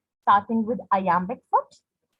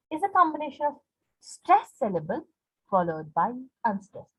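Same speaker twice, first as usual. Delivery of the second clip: ordinary delivery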